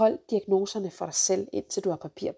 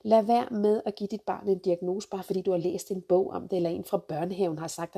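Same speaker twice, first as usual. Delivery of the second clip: ordinary talk, nothing marked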